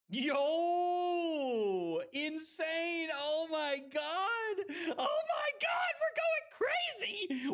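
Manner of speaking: high, shouting, over the moon; sudden triumphant whoop, pitch shooting way up; ecstatic win shouts. Real, not acted. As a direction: yell it like you just won big. Big win in the game.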